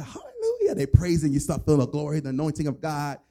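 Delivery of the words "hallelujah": high, soaring hallelujah; ecstatic praise. Retold or imitated. Prayer circle worshipper praising God, soaring high hallelujah. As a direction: cry it out like a worshipper caught up in glory. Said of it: "and everybody".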